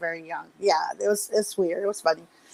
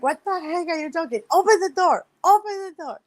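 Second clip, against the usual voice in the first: urgent demand; 9 semitones higher, faster than usual. Retold or imitated. Sister outside the bathroom demanding entry, acted urgency. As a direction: call out urgently, pitched way up.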